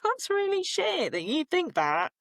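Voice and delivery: inner protest. Retold or imitated. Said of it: "but inside I'm like".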